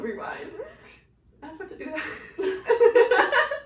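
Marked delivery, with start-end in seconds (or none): 1.4-3.7 laughing. Real, not acted. Flustered giggle while recalling quote.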